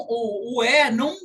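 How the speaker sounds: ordinary talk, nothing marked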